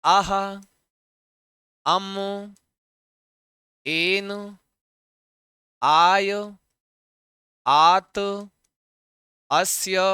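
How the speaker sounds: आह, अम, एन, आय, आत, अस्य, ए each said alone with a long pause after; one ending at a time. Shown as a demonstration. Teacher separating each ending for students to catch, long pauses. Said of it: "समझ रहे हैं जी?".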